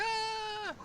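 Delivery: shrill screaming crowd; high crowd scream. Acted. Townsfolk fleeing the attack, high scream. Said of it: "crowd screaming".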